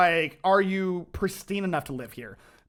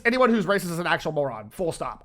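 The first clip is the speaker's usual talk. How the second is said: ordinary talk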